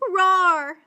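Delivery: loud short lion call for help; roar for help. Acted. Trapped lion roaring for help, loud. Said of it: "Then he roared for help".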